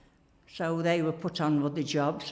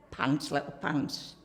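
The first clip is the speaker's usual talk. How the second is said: normal talking voice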